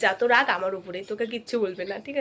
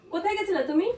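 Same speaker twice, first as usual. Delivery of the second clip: accusing; 6 semitones higher than usual. Acted. Staged jealous quarrel begins.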